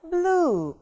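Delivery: blue with a long oo; drawn-out vowel. Shown as a demonstration. Teacher showing learners the stretched oo in blue. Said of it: "Мы тянем буковку У".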